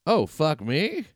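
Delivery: drawn-out rising mock yell; retold yelled comeback. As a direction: throw it back as an incredulous retort. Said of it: "yelled back".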